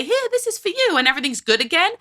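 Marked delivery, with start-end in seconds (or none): 0.0-1.0 put-on high-pitched little-kid voice; imitating a child. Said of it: "my child will immediately say".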